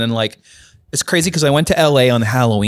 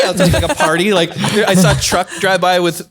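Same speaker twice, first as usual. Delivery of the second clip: ordinary talk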